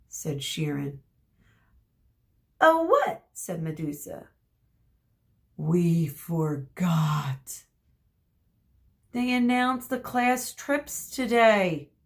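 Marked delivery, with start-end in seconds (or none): 2.6-3.3 high, loud, alarmed; alarmed question. Acted. Medusa startled by Chiron's oh, high and loud. 5.6-11.9 slow, groaning realisation; slow dismay. Acted. Chiron realising the class trips are today, slow dismay.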